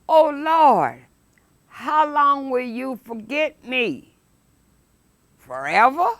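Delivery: preacher lamenting the psalm; fervent psalm reading. Acted. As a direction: plead it like a lament.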